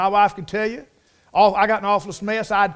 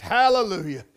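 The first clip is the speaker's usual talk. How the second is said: ordinary talk, nothing marked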